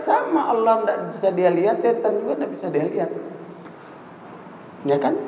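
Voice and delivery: ordinary talk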